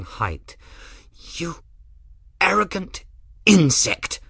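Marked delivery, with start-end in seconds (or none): 1.4-4.2 snarled. Acted. Rook snarling at Grim after the slap. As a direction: spit the insult out. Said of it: "he snarled".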